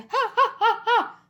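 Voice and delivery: high staccato "ha ha"; sung mock laugh. A narrator's reading. High mock cackle ending the song. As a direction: punch out high theatrical laughs. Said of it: "i śmieje się".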